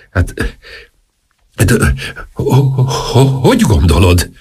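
halting stammer, repeating the first syllables. A narrator's reading. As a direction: trip over the words, stuck on ho-.

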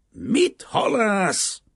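high, loud, drawn-out angry shout; rude roar. A narrator's reading. Banker cutting off talk of the fisherman, roaring rudely. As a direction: roar it back, stretching the words. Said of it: "ordult föl a bankár gorombán".

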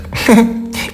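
short breath of a giggle; amused chuckle. A narrator's reading. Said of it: "János megint elmosolyodott".

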